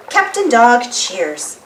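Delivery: plain reading voice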